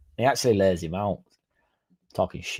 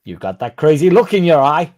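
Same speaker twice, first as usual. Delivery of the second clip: mock crazy-eyed call; 5 semitones higher, 9 dB louder than usual. Loud jokey line to viewer.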